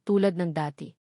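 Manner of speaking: ordinary delivery, nothing marked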